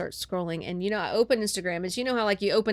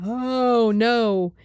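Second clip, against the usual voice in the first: retold dread; 4 semitones higher, 5 dB louder than usual. Retold dread as move gets real.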